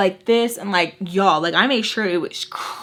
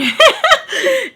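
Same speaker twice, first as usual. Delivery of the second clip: high laugh; 6 dB louder than usual. Real, not acted. Laughing about straightening hair with an iron, high laugh. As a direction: burst of laughter, much higher and louder. Laughing at her own joke.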